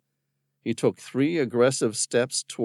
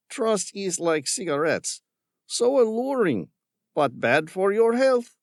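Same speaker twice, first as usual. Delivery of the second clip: higher, louder scornful villain; mocking villain; 13 semitones higher, 4 dB louder than usual. A narrator's reading. Villain scorning Tom's concern for the creatures. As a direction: sneer at the tree hugger. Ramirez voiced.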